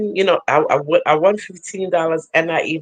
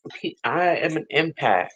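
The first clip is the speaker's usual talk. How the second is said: normal talking voice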